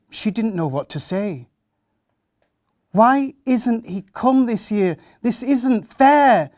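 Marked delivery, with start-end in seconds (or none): 2.9-6.5 high, loud protest, slowing on the last words; a boy shouting that Christmas is unfair; angry shout. Acted. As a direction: yell it like a cheated little boy. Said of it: "Sam kept on shouting out".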